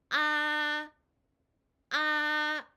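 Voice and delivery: 아 held long, high and level, loud; first tone. Shown as a demonstration. Teacher drilling learners in first tone, high level 아. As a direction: sustain 아 on one high, unwavering note. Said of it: "여러분 따라해보세요".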